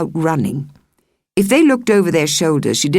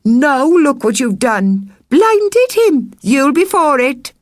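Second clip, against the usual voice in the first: high, loud tattling outrage; accusing children; 11 semitones higher, 4 dB louder, slower than usual. Acted. Children accuse the stone-thrower, high and loud. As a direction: gleefully accuse her.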